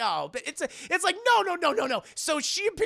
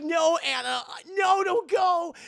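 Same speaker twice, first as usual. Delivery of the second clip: pleading shout; 10 semitones higher, 5 dB louder than usual. Retold or imitated.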